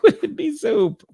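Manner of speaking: high-pitched, cracking up mid-question; laughing incredulously. Real, not acted. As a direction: laugh helplessly through the words.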